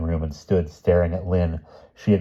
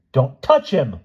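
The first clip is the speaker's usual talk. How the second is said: loud, high protective shriek; high-pitched frantic warning; 8 semitones higher, 5 dB louder, slower than usual. A narrator's reading. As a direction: shriek to fend them off. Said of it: "Lynn shrieked again".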